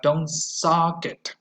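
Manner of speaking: very slow, don't sock it with an open ah; slow model phrase. Shown as a demonstration. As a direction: read slowly for repetition. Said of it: "We don't have anything".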